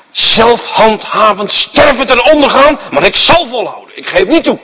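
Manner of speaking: the proud son vows never to give in, loud and high; defiant vow. Acted. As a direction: proclaim it like a proud son refusing to yield. Prodigal's defiant inner vow.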